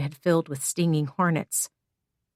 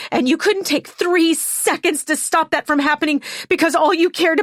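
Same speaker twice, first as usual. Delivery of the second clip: higher and louder, voice rising to a scream; loud furious shout; 8 semitones higher, 4 dB louder than usual. Acted. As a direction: let the grief turn to a loud shout. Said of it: "Hannah's voice was so loud".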